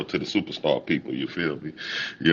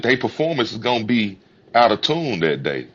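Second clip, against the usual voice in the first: ordinary delivery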